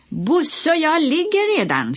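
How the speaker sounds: high, loud called-out fib; shouting. A narrator's reading. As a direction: yell it over to the neighbour. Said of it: "skrek Lasse".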